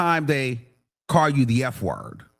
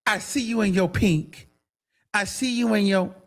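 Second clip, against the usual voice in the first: mock taunting woman; 5 semitones higher than usual. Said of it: "I was attacked at the Diddy".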